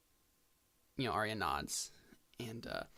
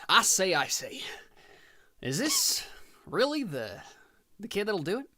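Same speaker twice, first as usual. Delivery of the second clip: put-on high, loud, old-fashioned gentleman; a blustering "I say, I say" toff; pompous agent; 9 semitones higher, 9 dB louder than usual. Agent doubting the dad, loud and high-pitched.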